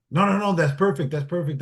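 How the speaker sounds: retold eager approval. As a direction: imitate impressed people cutting in to approve. Casting crew approves his tactical plan.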